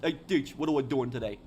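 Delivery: thicker New York City accent. Shown as a demonstration. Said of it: "the deeper New York accent".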